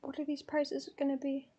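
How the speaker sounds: very soft, under the breath; hushed worry. Real, not acted. Fan dreading ticket prices, hushed worry. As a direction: fret quietly to yourself.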